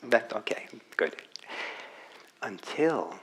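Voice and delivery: normal talking voice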